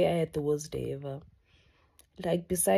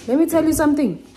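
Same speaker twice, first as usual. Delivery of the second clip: loud, fast and higher "Let me tell you something"; emphatic loud; 9 semitones higher, 10 dB louder, faster than usual. Shown as a demonstration. Repeated emphatic.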